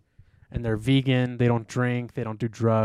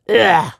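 vocal grunt; 9 semitones higher, 14 dB louder than usual. Retold or imitated. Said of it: "There's a part where he goes".

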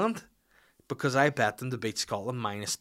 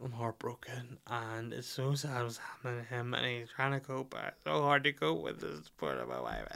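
softer, whispery mock-heartbroken plea; hammed-up tearful self, much softer; mock sobbing; 7 dB quieter than usual. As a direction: whine it like a teary husband. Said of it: "I was just like".

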